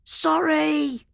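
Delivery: very high shouted "Sorry"; kid's called apology. A narrator's reading. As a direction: call it out as you run over. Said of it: "Sammy shouted".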